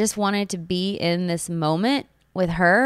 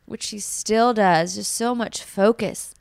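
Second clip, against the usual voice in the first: ordinary talk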